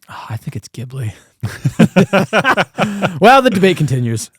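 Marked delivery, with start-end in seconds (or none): 0.7-1.1 Ghibli with a hard G, as "GIB-lee". Real, not acted. Co-host disagrees in the debate, hard-G Ghibli. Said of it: "Is it Ghibli or Ghibli?". 1.4-3.2 real burst of laughter. Real, not acted. Co-host amused that the debate is unsettled, laughing.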